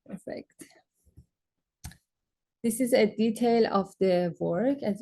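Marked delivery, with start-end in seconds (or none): 0.1-0.6 breathy whisper to herself; whispered aside. Real, not acted. Presenter checking her time, whispers to herself. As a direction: say it under your breath, relieved.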